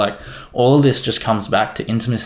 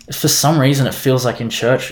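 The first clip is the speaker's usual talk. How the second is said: usual speaking voice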